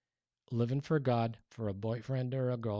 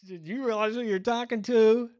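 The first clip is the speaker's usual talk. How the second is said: much higher, louder mock disbelief; an astonished whoa dude; incredulous; 8 semitones higher, 7 dB louder, slower than usual. Pastor reacting to the faith teacher, incredulous. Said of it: "And I just thought".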